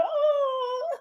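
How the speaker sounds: very high, soft drawn-out "oh"; mock whimper. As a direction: squeak out an exaggerated pained reaction. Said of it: "And I went".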